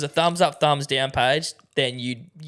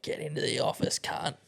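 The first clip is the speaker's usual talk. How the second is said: boss mode; 11 dB quieter than usual. Retold or imitated. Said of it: "seeing you in your boss mode".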